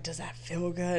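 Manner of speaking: lightly mock his bedroom voice; imitating him. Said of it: "he was like".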